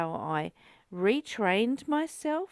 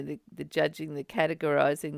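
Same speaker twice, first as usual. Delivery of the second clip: ordinary delivery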